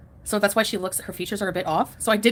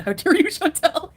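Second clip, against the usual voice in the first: slow, higher, laughing through it; mock outrage laughing; 7 semitones higher, 4 dB louder, slower than usual. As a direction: feign shock at the joke.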